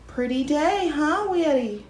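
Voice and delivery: talking to pet. Real, not acted. Talking to the dog.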